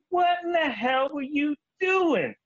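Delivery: hammed-up angry trooper; stern state trooper. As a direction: play the exasperated cop, slowly. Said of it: "He's like".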